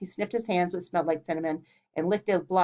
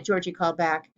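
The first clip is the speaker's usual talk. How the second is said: plain reading voice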